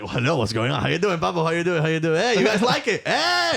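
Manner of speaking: high, bouncy, showman hellos; playful stage greeting. Retold or imitated. Comedian greeting the judges in his character voice, rising showman hellos. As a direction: work the room like a cocky entertainer.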